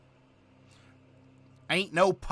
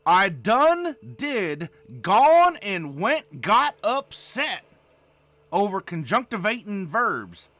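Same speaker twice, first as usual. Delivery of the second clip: put-on slow Southern accent; 3 semitones higher, 4 dB louder, slower than usual. Streamer slowly drawling the verbs in a hammed-up Southern accent. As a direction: stretch each word like a lazy Southern porch talker. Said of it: "With this here accent".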